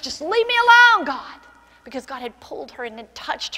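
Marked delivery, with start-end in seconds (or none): 0.0-1.4 desperate plea. Retold or imitated. Girl rejects God.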